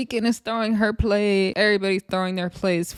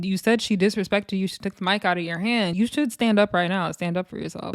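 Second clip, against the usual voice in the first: normal talking voice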